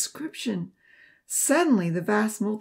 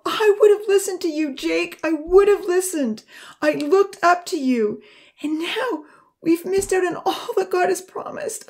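high, loud, tearful accusation; anguished outburst, rising to a high wail; bitter sobbing; 9 semitones higher, 6 dB louder than usual. A narrator's reading. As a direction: hurl the blame through bitter sobs, pitch climbing toward despair. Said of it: "she sobbed bitterly".

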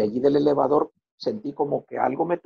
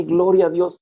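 higher and louder Gloria a Dios; shout; 5 semitones higher, 7 dB louder than usual. Retold or imitated. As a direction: cry it out in praise. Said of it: "grité". (grito de alabanza)

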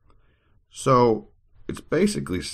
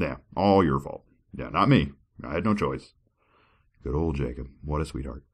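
usual speaking voice

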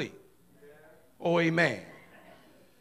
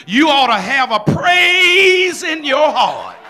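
loud, ringing church preaching; booming Sunday sermon voice; full-force preaching; 7 dB louder than usual. Acted. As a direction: preach it out to the whole congregation.

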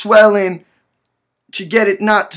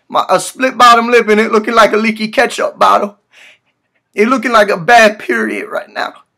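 ordinary talk

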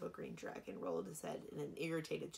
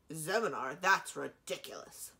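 scoffing; 9 dB louder than usual. Acted. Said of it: "Warwick snorted".

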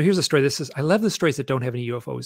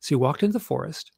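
ordinary delivery

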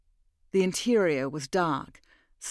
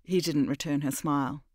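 ordinary delivery, nothing marked

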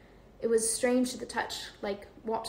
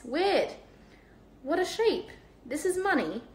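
put-on high, amazed boy voice; wide-eyed young boy; excited Ron voice; 6 semitones higher than usual.

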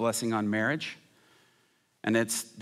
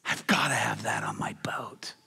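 breathy, hushed determination; urgent stage whisper; intense whisper. Acted. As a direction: whisper it with fierce resolve. Intense plea at sermon's opening.

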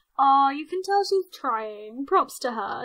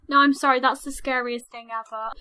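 ordinary talk, nothing marked

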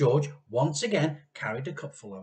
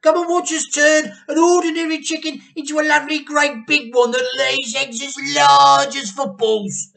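excited boast; 12 semitones higher, 12 dB louder than usual. A narrator's reading. Mr Cranky boasting to his wife, high excited call. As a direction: boast grandly about giant chickens.

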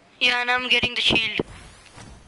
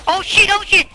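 sudden panicked yell, high and loud; startled yell; 7 semitones higher, 7 dB louder than usual. Real, not acted. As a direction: blurt it out in shock. Ambushed in game, panicked.

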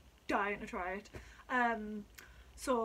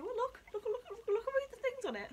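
much higher, urgent "look look"; bubbling with eagerness; excited; 11 semitones higher than usual. Real, not acted. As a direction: insist excitedly they see the label. Eager to show bottle label.

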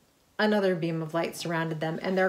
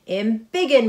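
loud heroic battle cry; yelled; 4 semitones higher, 9 dB louder than usual. A narrator's reading. Ms. Marvel using her power, yelling loudly. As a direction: shout the power word. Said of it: "Ms. Marvel yelled".

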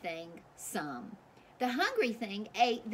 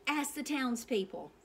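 ordinary delivery

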